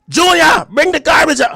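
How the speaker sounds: high shouted mom order; mimicking mom yelling. Streamer imitating his mom yelling at him, high shout. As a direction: shout the name like a bossy mother. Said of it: "So you like".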